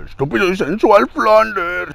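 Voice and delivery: laugh, then grumble like a cartoon dad; dopey dad impression; Homero Simpson. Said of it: "la voz de Homero Simpson".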